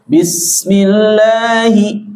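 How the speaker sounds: Bismillahi drawn out slowly, final hi clearly sounded; teacher chanting Bismillahi in full for the students. Shown as a demonstration. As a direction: recite Bismillahi slowly, holding the last hi. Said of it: "bukan bismillah".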